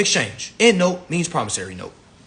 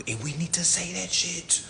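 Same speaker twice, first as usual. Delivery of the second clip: hushed, voiceless aside; whisper; 5 semitones higher, 5 dB quieter than usual. Real, not acted. Speaker to his audience about confronting elders, whispered. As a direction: whisper it like a shared secret.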